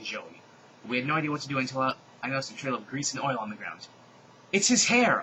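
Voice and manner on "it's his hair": high, loud eureka shout; excited yell. A narrator's reading. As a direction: shout the discovery. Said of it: "I yelled".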